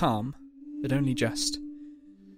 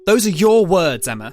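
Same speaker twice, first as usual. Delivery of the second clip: angry accusation; 4 semitones higher, 9 dB louder than usual. A narrator's reading. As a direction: throw her own words back at her. Angry father on phone.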